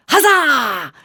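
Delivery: high, drawn-out hammed-up cheer; triumphant mock cry. Creature startling the dog with jazz hands, hammed-up cry. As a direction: fling it out like a showman.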